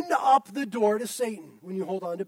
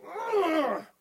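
frustrated groan; 6 semitones higher than usual. Retold or imitated. Bitter person sulking in room.

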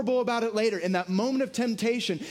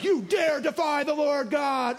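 David's battle cry; 6 semitones higher than usual. Retold or imitated. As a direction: hurl the challenge like a war cry. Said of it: "He's like".

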